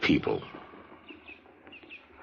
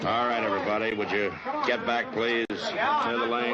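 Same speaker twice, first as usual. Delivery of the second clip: raised voice ordering the crowd back; calling to crowd; 8 semitones higher than usual. Acted. Marshal orders spectators back, calling out. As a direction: project it out over the crowd.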